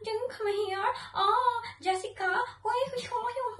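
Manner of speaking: very high-pitched put-on English voice; mock English conversation. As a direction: call the girls in a silly, squeaky mock voice. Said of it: "talk to them like". (hataas nga tingog nga nagsundog-sundog og Iningles)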